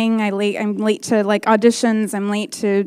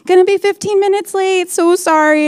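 apologetic texting; 9 semitones higher than usual. Retold or imitated. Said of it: "like, texting him".

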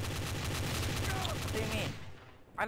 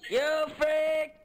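higher and louder mock insult; playful yell; 6 semitones higher, 6 dB louder than usual. Real, not acted. Yelling at the player who got him, playful. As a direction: shout it at the other player.

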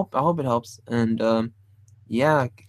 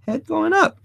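head going up said much higher; rising head. Shown as a demonstration. Teacher showing the pose, voice shooting up high. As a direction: lift the voice up high as the head goes up. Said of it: "Now watch this".

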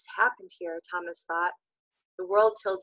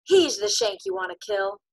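mad voice; 6 semitones higher, 4 dB louder than usual. Acted. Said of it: "His voice was full of madness".

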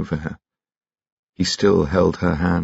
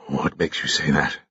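hoarsely; 2 semitones lower, 4 dB quieter than usual. Acted. Carrados questioning Alain, hoarse and strained. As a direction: rasp it out under strain. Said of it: "asked Carrados hoarsely".

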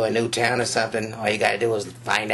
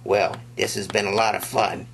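usual speaking voice

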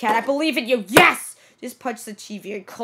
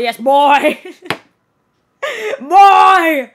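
loud, hammed-up bellow; mock Kratos shout; 4 semitones higher, 11 dB louder, much slower than usual. Streamer quotes God of War, mock shouting. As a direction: bellow it like the game's father. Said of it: "From God of War gameplay".

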